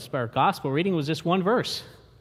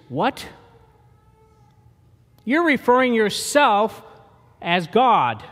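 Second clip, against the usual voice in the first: mock outraged, higher and louder; outraged Pharisees; 4 semitones higher, 5 dB louder than usual. Pharisees reacting to Jesus, indignant and louder. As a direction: spit out the shock of the Pharisees.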